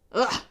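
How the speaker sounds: short, loud ugh; disgusted groan. A narrator's reading. Villain hears Percy's guess, disgusted groan.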